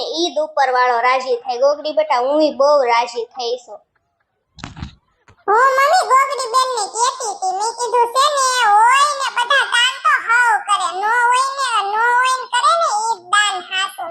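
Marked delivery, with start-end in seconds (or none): 5.5-14.1 put-on much higher, childlike teasing voice; high kiddish voice taunting her sister, giggling; cheeky little sister. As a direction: play the spoiled kid sister gloating over her big sister. Younger sister Jhinki chimes in.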